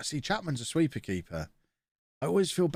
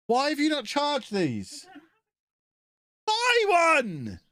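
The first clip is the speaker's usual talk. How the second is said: much higher, louder, slow disbelief; exasperated bickering; 4 dB louder, slower than usual. Real, not acted. Streamer to partner over uncharged batteries, exasperated bickering. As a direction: scold her for not charging them.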